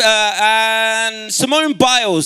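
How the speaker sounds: high, loud, slow announcement; rousing call-out. Real, not acted. Speaker hailing a gymnast to the audience, rousing call. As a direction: draw the name out for the crowd. Calls out names to crowd.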